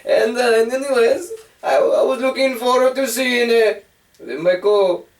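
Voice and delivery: mock Russian accent, broken English. Fake Russian guest grumbles about Russia in a hammed-up Russian accent. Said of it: "Now I'm talking like Russian".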